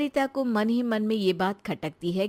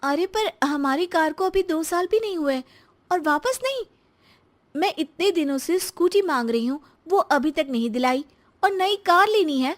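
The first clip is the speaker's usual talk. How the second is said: a higher, louder complaining wife; annoyed wife; 8 semitones higher, 4 dB louder than usual. Acted. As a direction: protest the new car, fed up. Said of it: "फिर से नाराज हो जाती है". (Scooty न मिलने पर नाराज़ पत्नी, ऊँची आवाज़ में शिकायत)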